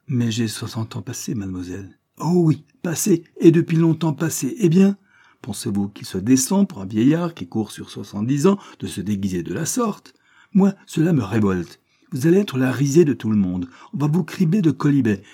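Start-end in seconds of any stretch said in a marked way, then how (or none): 2.2-5.0 high, loud sarcastic echo; scornful agreement. A narrator's reading. Cornélie mocks his age. 10.5-15.2 raised, emphatic warning; indignant scolding. A narrator's reading. Cornélie scolds, says she is revolted.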